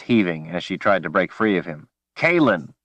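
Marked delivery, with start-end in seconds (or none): 2.2-2.7 urgent call. Acted. Man calling panicked woman.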